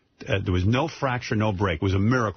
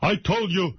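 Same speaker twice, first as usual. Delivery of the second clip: higher, slower, a hint of a scolding father; stern father; 9 semitones higher than usual. Daughter imitating her upset father, higher and scolding. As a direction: scold like a fussy old father. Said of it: "He goes".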